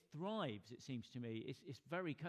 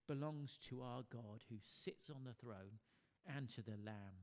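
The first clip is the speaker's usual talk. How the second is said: usual speaking voice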